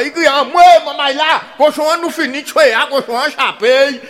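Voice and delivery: mock alarmed villagers shouting. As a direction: shout it out, the pig got away. Men shout the pig escaped.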